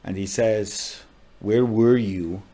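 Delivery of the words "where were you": retold protest. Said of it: "he protests".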